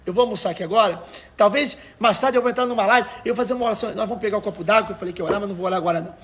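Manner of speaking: ordinary talk, nothing marked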